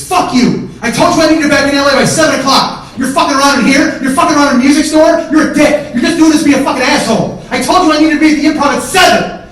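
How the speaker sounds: high, very loud tirade; full-force screamed accusations; furious yelling. Retold or imitated. Furious brother berating him in the store, yelling at full force. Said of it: "Stop yelling".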